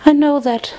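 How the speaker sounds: high-pitched, tearful acceptance; Fluttershy, tearful. A narrator's reading. Crying friend answering Twilight, tearful. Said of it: "tears still streaming".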